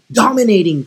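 loud, forceful burst; dominating voice. Shown as a demonstration. Preacher illustrating a voice that dominates people, loud and forceful. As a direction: hit the first word hard and loud.

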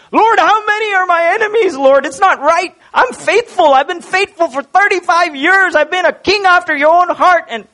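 high, pleading complaint to God, well above the normal voice; a hurt, high-pitched lament, pleading and indignant; David crying out. Retold or imitated. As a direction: wail your grievances up to the Lord like a wronged king. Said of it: "David starts off with crying out".

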